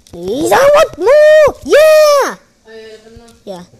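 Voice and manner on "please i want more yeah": high, drawn-out loud plea; loud, slow, squealing insistence; excited begging. Real, not acted. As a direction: shout a greedy plea, then cheer. Begging for more in trade.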